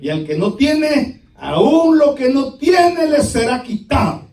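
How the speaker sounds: slow, high, loud preaching; proclaimed judgment. Acted. Master stripping the one who has nothing, loud proclamation. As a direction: hammer the sentence home, drawn out.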